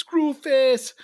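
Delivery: high, hammed-up Jamaican accent; bad Jamaican accent. Podcaster naming the film's villain, mock Jamaican accent. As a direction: put on a cheesy Jamaican accent. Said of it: "adopt a bad Jamaican accent".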